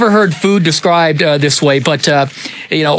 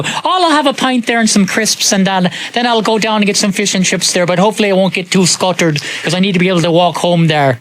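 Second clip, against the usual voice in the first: lilting, higher Irish pub talk; Irish accent; 3 semitones higher than usual. Retold or imitated. Mimicking Irish pub talk, light Irish accent. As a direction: put on an Irish accent ordering a pint. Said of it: "you end up talking like they do".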